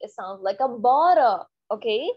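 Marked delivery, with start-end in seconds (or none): 0.8-1.4 "bottle" as a drawn-out "baa-ro". Shown as a demonstration. Teacher shows how it sounds, R for T. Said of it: "B O आर L E".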